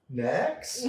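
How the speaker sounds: mock-alarmed East Texas host calling next; brisk dismissal. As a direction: move on fast, as if scared off. Cutting off the scary guest.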